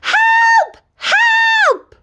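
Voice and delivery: high cries for help; screaming for help. Acted. Girl flees the wolf, screaming high cries for help. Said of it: "ran screaming".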